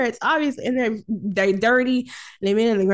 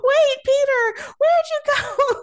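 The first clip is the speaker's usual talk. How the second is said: high, drawn-out call after someone; mock panicked call; 3 dB louder than usual. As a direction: imitate a whiny, alarmed cry for a companion. Retells her calling after Peter.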